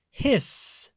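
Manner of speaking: long breathy hiss, no voice; goose hiss. A narrator's reading. Reading to children, an unvoiced goose hiss. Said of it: "said the goose".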